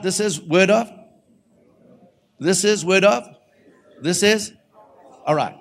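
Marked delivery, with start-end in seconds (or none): 0.0-4.5 high, loud call-out to the room. Real, not acted. Preacher getting everybody to call out the points, calling out. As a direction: lead the room loudly, leave the line hanging. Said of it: "So everybody call out".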